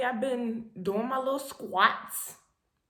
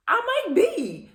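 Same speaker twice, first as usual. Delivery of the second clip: mock dawning realization; 13 semitones higher, 4 dB louder than usual. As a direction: squeak it out as the truth dawns. Self-quote, realizing possible pregnancy.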